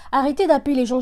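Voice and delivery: firm loud telling-off; scolding command. Real, not acted. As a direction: tell everyone off at once. Scolding people who call non-stop.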